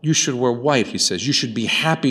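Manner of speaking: normal talking voice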